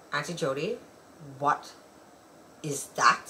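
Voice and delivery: put-on low, hushed, halting child's question; hesitant questioning. Child questions auntie about pasties, hesitant and hushed. Retelling kid's reaction to pasties.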